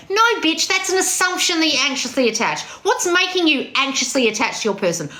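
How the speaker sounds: high-pitched blunt correction; emphatic scolding. Real, not acted. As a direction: cut them off and correct them, starting high. Rebutting a chat member.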